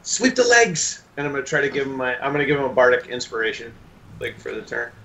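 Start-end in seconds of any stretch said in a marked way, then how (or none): 0.0-1.0 high, drawn-out coaching cry; in-character shout of encouragement; acted battle call. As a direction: call out the order high and slow. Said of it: "I'm just gonna be like".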